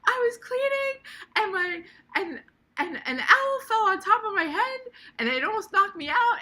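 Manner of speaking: whiny wail. Retold or imitated. Retelling her complaint to Cheryl.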